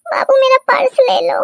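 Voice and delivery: put-on high, babyish whimper; tearful. Weeping bride asks her husband to take her purse, tearful. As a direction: whine it weepily.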